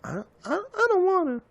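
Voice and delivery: put-on very high, stammering childish whine; scared whiny refusal. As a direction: whimper it like a frightened kid. Retold scared refusal to sleep upstairs.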